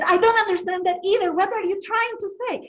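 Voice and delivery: mock-heated demand to the classmate; exasperated retort. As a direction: let the frustration burst out. Frustrated second request, retold.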